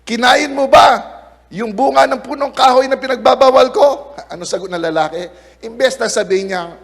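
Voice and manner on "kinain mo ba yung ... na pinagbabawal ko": God calling Adam. Acted. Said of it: "Sabi niya".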